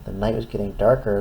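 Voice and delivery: ordinary delivery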